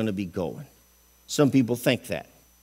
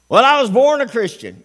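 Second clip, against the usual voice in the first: mock excuse; 9 semitones higher, 8 dB louder than usual. Mocking a churchgoer's excuse.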